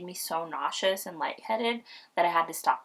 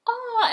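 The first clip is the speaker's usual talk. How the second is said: imitated baby cry; 8 dB louder than usual. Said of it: "And she's just like".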